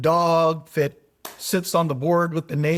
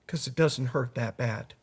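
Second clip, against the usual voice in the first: low, soft punchline delivery; laconic old farmer; 5 semitones lower, 7 dB quieter than usual. Retold or imitated. Old farmer answering his neighbor, laconic. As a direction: drop down into an understated answer. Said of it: "The old farmer says".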